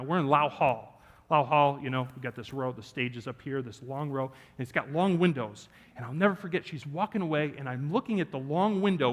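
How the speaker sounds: ordinary talk, nothing marked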